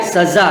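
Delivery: सजा with z in place of j; nukta pronunciation. Shown as a demonstration. Teacher repeats nukta word, सजा with z. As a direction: say सजा as "sazaa". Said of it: "नुक्ते वाला".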